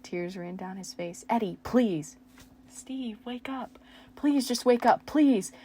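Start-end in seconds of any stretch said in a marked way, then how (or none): 1.3-2.2 loud begging cry; pleading cry. Acted. Steve begging Eddie to wake, loud pleading cry. 2.7-5.5 sobbing plea. Acted.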